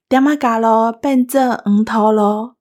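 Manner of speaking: ordinary talk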